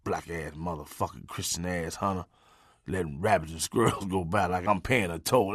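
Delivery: low, soft grumbling dog voice; dog grumbling in his ear in the truck, low and soft; mock dog growl. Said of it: "The dog was in my ear growling".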